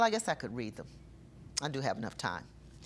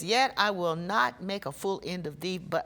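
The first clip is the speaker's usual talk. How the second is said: solemn scripture reading; 2 semitones higher, 5 dB louder than usual. Acted. Quotes the verse again.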